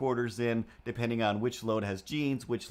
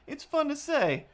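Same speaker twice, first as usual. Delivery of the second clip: much higher, playful voice; playful squeak. Pretend. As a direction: chirp it like a delighted kid. Playful high comment.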